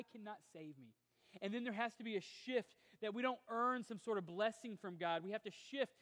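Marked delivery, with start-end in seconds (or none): 1.4-5.9 loud, high, driving sermon delivery; preaching at full force. Acted. Pastor to his congregation, preaching at full force. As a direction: preach it out to the whole room.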